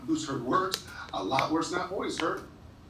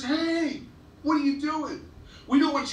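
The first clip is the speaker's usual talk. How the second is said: retold shout, pitched much higher; mock yell; 12 semitones higher than usual. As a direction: imitate a mean girl calling across the lunchroom, high. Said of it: "she yells out loud".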